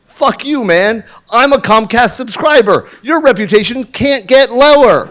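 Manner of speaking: mock angry retort. Retelling his comeback to the Comcast guy, hammed-up and loud. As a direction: throw it back at him, half joking. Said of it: "And I'm like".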